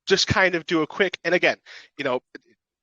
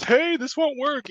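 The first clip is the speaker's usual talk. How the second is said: mock complaining user; 11 semitones higher, slower than usual. Retold community member complaining.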